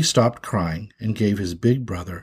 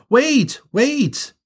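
high, urgent yell; alarmed high-pitched shout; 12 semitones higher than usual. Acted. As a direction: cry out to stop someone. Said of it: "when Binky yelled".

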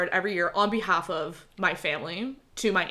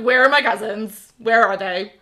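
louder, pointed question; loud mock demand; 2 semitones higher, 8 dB louder than usual. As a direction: call out for the cousins, loudly. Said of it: "I was like".

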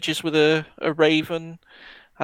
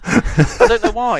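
ordinary talk

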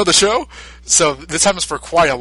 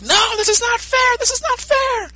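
high, slow, shrill protest; whiny tantrum. Retold or imitated. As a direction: throw a tantrum at losing. Frieza beaten, protesting.

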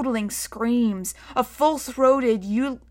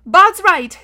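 put-on high, loud child's voice; loud, high-pitched girlish insistence; exasperated girl; 9 semitones higher, 11 dB louder than usual. A narrator's reading. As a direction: declare it firmly, pitched right up. Exasperated girl agrees in story.

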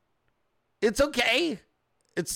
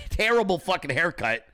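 ordinary talk, nothing marked

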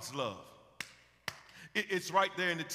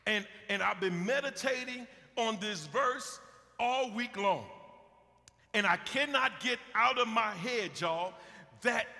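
ordinary talk

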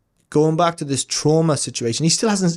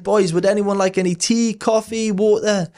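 imitating a cheerful helper, pitched higher; a chirpy, eager host voice, lightly done; bubbly offer; 7 semitones higher than usual. Imitating a cheerful colleague offering drinks.

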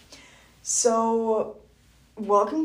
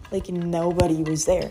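ordinary talk

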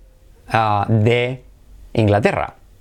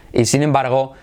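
usual speaking voice

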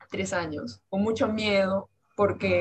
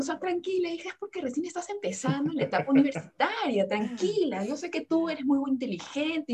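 reassuring mother; 6 semitones higher than usual. Retold or imitated. Mother quoted reassuring her.

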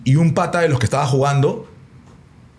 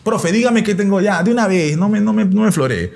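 impatient player; 7 semitones higher than usual. Retold or imitated. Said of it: "me metí un floro".

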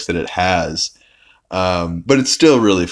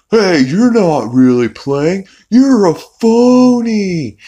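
high, slow sneering cartoon voice, much louder; accusing phony guy; 11 semitones higher, 6 dB louder, slower than usual. Phony guy calling Peter out in a high, slow shout. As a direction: drag out you're a phony in a high yell. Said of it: "And the guy's like".

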